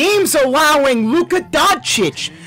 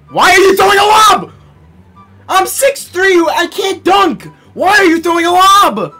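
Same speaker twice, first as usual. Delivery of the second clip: raging gamer; 9 semitones higher, 5 dB louder than usual. Real, not acted. Yelling at teammate AI.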